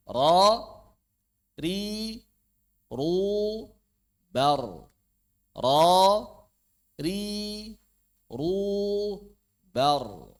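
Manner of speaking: drilled slowly syllable by syllable, thick open "Ro"; ro dengan mulut terbuka. Shown as a demonstration. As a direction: sustain "ri" and "ru", each syllable on its own. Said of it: "Tapi dibuka". (lafalkan "Ro, ri, ru, bar" satu per satu, tebal dan panjang)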